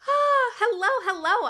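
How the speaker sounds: high, loud delighted hello; excited greeting. Real, not acted. Streamer greets first viewers arriving, excited. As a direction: squeal a welcome to viewers.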